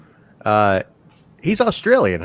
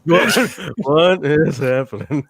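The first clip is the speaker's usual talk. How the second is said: laughing disbelief; much slower than usual. Real, not acted. Repeat, slow laughing.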